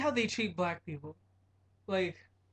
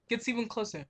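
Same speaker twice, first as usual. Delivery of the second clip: ordinary delivery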